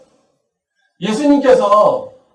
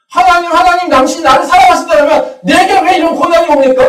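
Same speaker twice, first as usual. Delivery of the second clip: anguished complaint; 9 semitones higher, 8 dB louder than usual. Pretend. Believer's complaint to God voiced.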